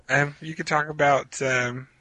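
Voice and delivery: ordinary delivery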